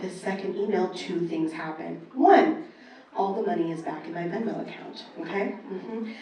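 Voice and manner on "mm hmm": usual speaking voice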